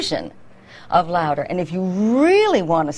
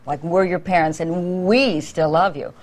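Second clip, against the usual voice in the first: usual speaking voice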